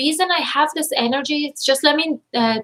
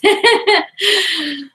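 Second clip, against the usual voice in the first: teary laugh; 8 semitones higher, 3 dB louder than usual. Real, not acted. Overcome reading kind comments.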